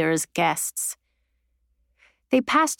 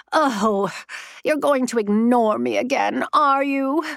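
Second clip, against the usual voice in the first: petulant ghost voice; 5 semitones higher than usual. A narrator's reading. Ghost scolds ignoring Mallory.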